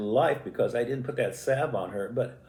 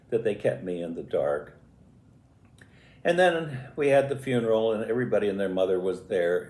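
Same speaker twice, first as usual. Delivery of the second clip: ordinary talk, nothing marked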